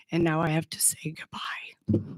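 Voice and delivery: real goodbye, barely voiced; choked whisper. Real, not acted. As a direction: let the words fall to a whisper, moved. Emotional farewell to clinic friends.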